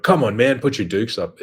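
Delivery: hammed-up dare to put the dukes up; mock tough-guy challenge. Mock tough guy daring someone over a nose insult. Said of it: "I'm not gonna sit there and be like".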